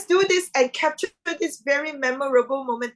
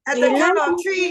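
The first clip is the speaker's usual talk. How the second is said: loud, higher group cue; rallying call; 4 semitones higher, 6 dB louder than usual. Real, not acted. Host cueing everyone to thank Karen, loud rallying call.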